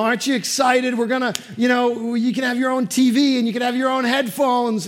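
high, loud, eager coaxing; dad selling the trip to his son, high and eager. Retold or imitated. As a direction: talk a toddler into the trip, bright and pleading.

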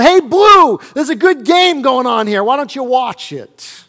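loud, high heckling yell at the umpire; a fan shouting at the ref. Retold or imitated. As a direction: bellow it from the stands. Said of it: "someone yells out".

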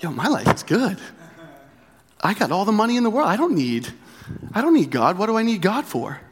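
put-on cocky rich young ruler. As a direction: play the smug rich kid who needs nothing. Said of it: "like the rich young ruler".

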